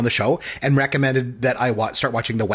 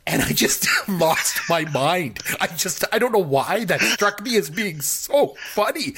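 words squeezed out through real laughter; can barely get it out for laughing; laughing while talking; 5 semitones higher, slower than usual. Real, not acted. Laughing while recounting the scene.

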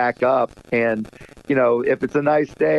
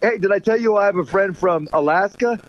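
eager, higher-pitched joke opener; dad-joke setup; 6 semitones higher than usual. Retold or imitated. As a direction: set up a silly joke for the kids. Dad telling his stupid joke.

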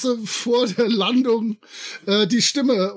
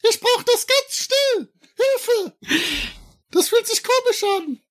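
helium voice; 12 semitones higher than usual. Acted. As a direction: panic cries on helium. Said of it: "dieses Helium tödlich".

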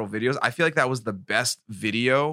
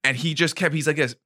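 ordinary talk

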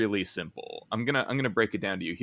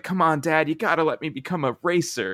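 put-on pleading kid; 7 semitones higher, 4 dB louder than usual. Kid begging his dad.

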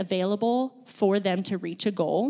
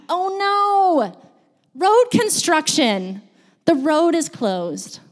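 slow, higher-pitched pretend alarm; mock dismay; 6 semitones higher, 4 dB louder, slower than usual. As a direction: act out hitting a roadblock. Acts out hitting a roadblock.

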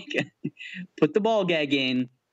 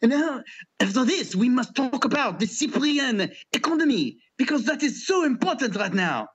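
mock announcer; 7 semitones higher than usual. Mock pompous announcer segue.

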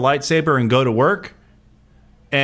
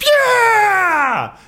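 high, loud, full-throated cheer; crowd cheer; 4 dB louder than usual. Retold or imitated. Fans cheering the logo in the theater, a loud yell. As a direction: cheer as the logo hits the screen. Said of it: "and we all went".

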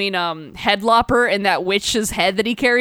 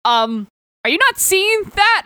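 higher, incredulous mock witch; exasperated witch; 9 semitones higher than usual. Witch asks the barbarian about the cloud, higher incredulous. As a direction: point out the obvious, disbelieving. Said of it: "and then she's like".

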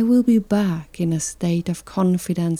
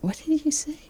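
high, soft, baffled question; hushed shocked reply, pitched well up; stunned disbelief; 8 semitones higher, 7 dB quieter, faster than usual. Retold or imitated. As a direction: ask it as if you can't believe your ears. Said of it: "And you look at him like".

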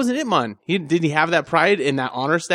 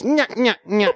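high taunting nya nya; sing-song playground taunt; mocking nyah laugh; 7 semitones higher, slower than usual. Eye poke sound effect mock.

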